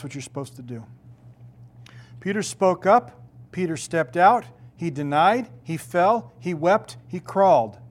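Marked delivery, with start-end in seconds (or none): 2.2-7.8 loud, punchy short sermon lines; rising preacher's fervor; full-force preaching. Real, not acted. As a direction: hammer each phrase from the pulpit.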